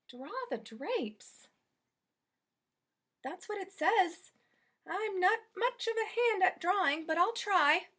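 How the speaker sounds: hesitant but willing; Amelia Bedelia. Acted. Maid puzzling over drawing drapes, modest.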